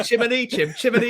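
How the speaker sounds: high, bright mock Cockney sing-song; Cockney accent.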